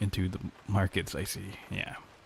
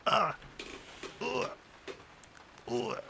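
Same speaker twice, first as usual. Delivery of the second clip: high, strained grunts of effort; stretching groans; 10 semitones higher, 5 dB quieter, much slower than usual. Real, not acted. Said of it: "I have to stretch".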